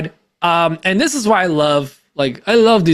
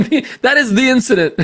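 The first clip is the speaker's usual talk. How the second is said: laughing; 7 semitones higher than usual. Real, not acted. Laughing at joke.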